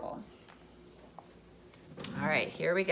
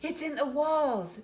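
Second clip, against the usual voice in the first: higher, louder startled owl cry; a hammed-up startled screech; 5 semitones higher, 5 dB louder than usual. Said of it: "the owl screeched".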